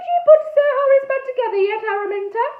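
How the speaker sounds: very high, loud scolding; angry snap. A narrator's reading. Aunt Tabby catches Araminta with the head, loud high-pitched snap. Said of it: "she snapped".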